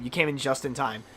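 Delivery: ordinary talk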